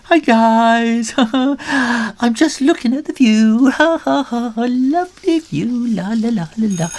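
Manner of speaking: high forced laughing and humming; nervous fake laugh. Stuck Ginger pretends to admire view, forced high laugh. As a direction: cover embarrassment with a fake cheery laugh. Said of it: "He's going".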